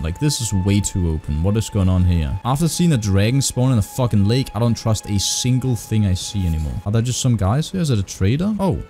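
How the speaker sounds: ordinary talk, nothing marked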